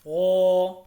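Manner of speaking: ओ as a heavy rolled ro, vowel held; thick, lengthened. Shown as a demonstration. Teacher shows learners a heavy letter, thick ro held one alif. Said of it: "रो मोटा लफ्ज़ है".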